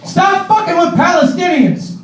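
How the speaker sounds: imitating a man shouting from the subway; retold yell. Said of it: "he just points and he goes".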